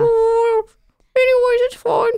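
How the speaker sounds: tiny high squeaky voice. Acted. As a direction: speak like a small trapped creature. Kevin trapped inside.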